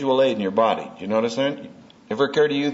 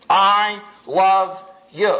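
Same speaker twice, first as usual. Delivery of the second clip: slow, tender I love you; 5 semitones higher, 4 dB louder, slower than usual. Shown as a demonstration. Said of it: "that's how you say what you say".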